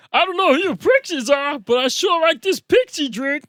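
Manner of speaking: high, loud cartoon drunk voice; full-out cartoon impression; Barney Gumble. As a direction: push it high and loud like a soused cartoon regular. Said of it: "I do a really good Barney Gumble".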